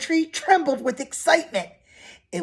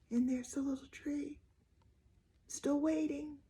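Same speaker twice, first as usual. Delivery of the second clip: breathy storyteller's whisper; 4 semitones higher, 16 dB quieter than usual. Acted. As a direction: whisper it like a secret to the kids. Whispered aside to the children.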